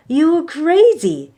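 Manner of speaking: high, loud disbelieving cry; exclaiming. Acted. Third brother exclaims at Maui, high and loud. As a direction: blurt it out in shock. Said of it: "exclaimed third oldest brother".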